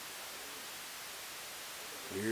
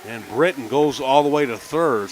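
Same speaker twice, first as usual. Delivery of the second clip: loud, high, rapid-fire call as the run scores; excited play call; 5 semitones higher, 11 dB louder than usual. Real, not acted. Announcer shouting the big play to radio listeners, excited and loud. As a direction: let it erupt as the ball gets away.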